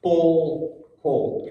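slow, weighted word by word; measured, deliberate scripture reading. Shown as a demonstration. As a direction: read it slowly, letting each word land. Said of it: "the slower you read it".

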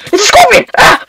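high panicked shout; scared shout. Real, not acted. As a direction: yelp it out as the scorpion appears. Startled by scorpion in game.

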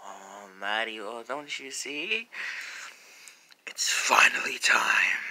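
slow, creepy whispered menace; weird evil voice. Acted. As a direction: draw it out like a sinister villain. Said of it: "that weird evil voice".

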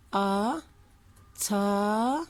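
"A, ta" said as separate syllables with pauses; sounding out words. Shown as a demonstration. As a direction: sound out "A" and "ta" slowly. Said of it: "Nasıl ayırıyoruz çocuklar".

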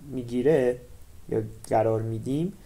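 usual speaking voice

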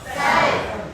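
쌀 said high with a tense ss; a sharp high 쌀 with the tense consonant; say ssal. Shown as a demonstration. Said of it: "쌀 발음해보세요". (쌀을 된소리로 높게)

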